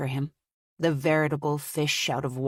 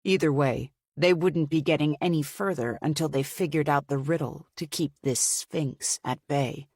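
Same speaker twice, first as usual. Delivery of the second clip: usual speaking voice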